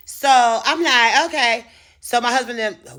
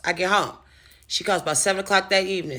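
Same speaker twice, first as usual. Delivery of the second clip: ordinary delivery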